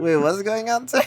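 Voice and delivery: high, rising disbelief; baffled. Real, not acted. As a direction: cut in with a high-pitched, confused question. Baffled interruption of the debater.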